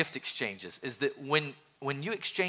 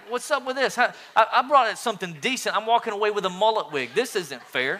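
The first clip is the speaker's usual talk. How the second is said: higher, faster, indignant griping; mock whiny complaint; 6 semitones higher, 4 dB louder than usual. Pastor griping about ending up with a mullet wig, mock whiny. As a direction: whine like a cheated gift-swapper.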